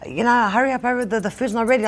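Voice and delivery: flustered wife hurrying everyone along; mock panic.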